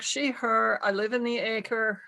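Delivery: normal talking voice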